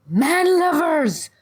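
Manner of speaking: high, long, loud jeer; scream. A narrator's reading. Sister screams after the fleeing pair, accusing. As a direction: scream it after them, drawn out. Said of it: "the sister screamed after them".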